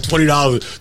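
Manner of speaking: usual speaking voice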